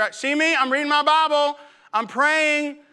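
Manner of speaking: high-pitched mock showing off; look-at-me eagerness. Believer shows God his good works, eagerly. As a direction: call for attention, pitched well up.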